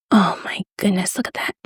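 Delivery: hushed amazement; awed whisper. Real, not acted. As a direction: whisper it in wonder.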